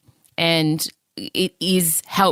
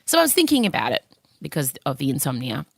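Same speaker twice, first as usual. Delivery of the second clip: ordinary delivery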